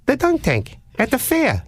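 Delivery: high, sing-song caller voice on the dunk tank line; odd prank caller. Retold or imitated. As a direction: lilt it way up like the weird caller. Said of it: "the voice at the other end says".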